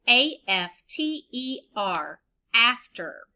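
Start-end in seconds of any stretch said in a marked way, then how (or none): 0.1-2.2 slow, clear letter-by-letter spelling; teacher spelling each letter for the class; spelled out letter by letter. Shown as a demonstration. Teacher spelling to children, slow and clear. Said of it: "say it, spell it".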